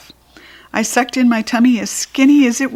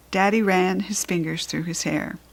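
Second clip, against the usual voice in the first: ordinary delivery, nothing marked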